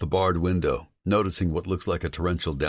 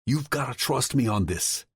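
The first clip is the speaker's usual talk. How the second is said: higher, pleading voice; desperate plea; 9 semitones higher than usual. Acted. Tom begging Vincent to trust him, a touch desperate. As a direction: beg him to listen. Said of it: "he says desperately".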